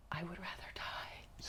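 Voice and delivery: faint, whispered inner dread; horrified whisper. Retold or imitated. Woman retells her horror at her own command, horrified whisper. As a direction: whisper it as if mortified.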